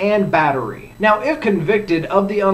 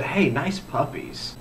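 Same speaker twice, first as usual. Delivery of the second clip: soft, slow mock come-on; lightly acted catcall, quiet and drawn out; 3 semitones lower, 10 dB quieter, slower than usual. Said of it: "looked at a woman and said".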